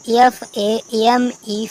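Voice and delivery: letters A M E spelled one by one, slowly; slow, deliberate letter-by-letter spelling. Shown as a demonstration. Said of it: "lame means लंगड़ा या झूठ".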